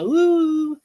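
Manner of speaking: high playful woo, a howl-like call; werewolf woo call. Retold or imitated. Streamer greeting a friend from werewolf streams, playful high woo. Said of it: "I hope you still also say "ah, woo".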